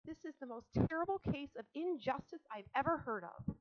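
high, loud outrage; indignant protest. A narrator's reading. Girl accusing her father of injustice, indignant. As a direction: declare it with passionate indignation.

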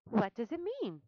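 ask, puzzled; Charlotte, puzzled. A narrator's reading. Charlotte asking the rat, mildly puzzled. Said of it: "asked Charlotte".

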